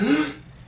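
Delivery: quick high inhaled gasp; sharp high gasp. Retold or imitated. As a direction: suck the air in. Mimicking a posing breath.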